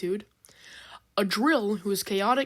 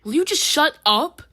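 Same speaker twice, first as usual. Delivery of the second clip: higher, louder angry retort; angry snap back; 7 semitones higher, 8 dB louder than usual. A narrator's reading. Not Ink Splash snapping at noisy Drill, angry. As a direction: shoot it back, fed up. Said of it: "angrily shoots back".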